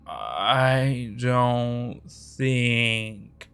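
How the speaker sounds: very slow, drifting slur; fuzzy, confused. Acted. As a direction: let the words drag out dazed. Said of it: "fuzzy, confused voice".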